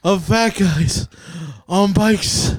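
halting speech with a break every few words; wheelchair kid imitation. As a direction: mimic a TV kid gasping between phrases. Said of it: "sounds like the wheelchair kid".

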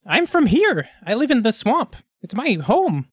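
high-pitched kobold. Acted. Kobold telling the party its home, high voice.

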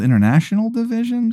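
high-pitched puzzled query; incredulous echo. Acted. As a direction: echo it in mock disbelief. Wizard queries the rival's title.

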